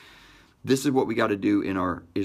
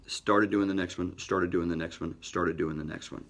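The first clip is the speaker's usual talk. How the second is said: each repeat softer and lower until it nearly disappears; fading repetition; 6 semitones lower, 5 dB quieter than usual. Acted. Telling listeners how he kept grinding through reps, fading away. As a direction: let the words trail away like tired reps.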